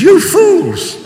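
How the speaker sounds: high, loud shout of rebuke; angry rebuke. Retold or imitated. Paul shouting at the Galatians in anger. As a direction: roar it at them like a furious apostle. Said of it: "that's quite strong".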